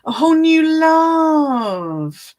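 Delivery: high, slow, loud gushing; tender gush. Real, not acted. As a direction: swoon over the compliment. Reading a sweet comment.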